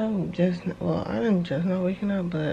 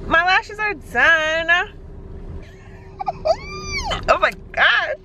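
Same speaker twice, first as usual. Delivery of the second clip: excited shout; 10 semitones higher, 5 dB louder, slower than usual. Real, not acted. Lashes done, excited.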